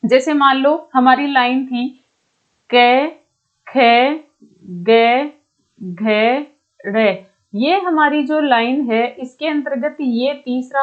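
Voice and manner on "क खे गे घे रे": isolated letters with a clear pause after each; letter-by-letter recital. Shown as a demonstration. Teacher showing students a consonant row, spaced out letter by letter. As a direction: recite क, खे, गे, घे, रे one by one.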